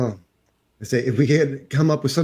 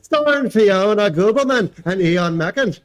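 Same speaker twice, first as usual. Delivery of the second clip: Irish brogue; 8 semitones higher than usual. Pretend. Husband reading the Irish movie listing in an Irish brogue. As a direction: read the listing like a hammed-up Irishman. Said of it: "in your Irish brogue?".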